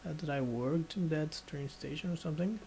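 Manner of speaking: ordinary delivery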